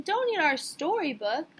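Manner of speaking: high, loud protest; children's reproach. A narrator's reading. Children to Sammy who ate their book, loud reproach. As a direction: scold a classmate loudly.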